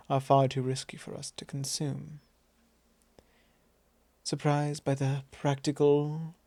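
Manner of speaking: normal talking voice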